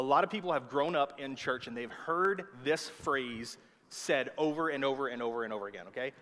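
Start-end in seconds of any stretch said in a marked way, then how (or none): none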